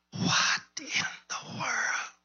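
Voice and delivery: hushed, slow, wondering aside; puzzled whisper. Retold or imitated. Preacher whispering the captain's puzzled question.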